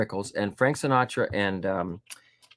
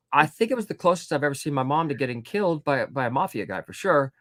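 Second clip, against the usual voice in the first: ordinary delivery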